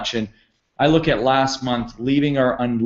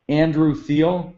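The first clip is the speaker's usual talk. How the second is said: normal talking voice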